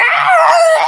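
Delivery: long, loud, very high scream; mock scream. Shot off the school, long mock scream. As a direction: scream like you're flung through the air, hammed up.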